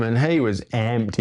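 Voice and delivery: ordinary delivery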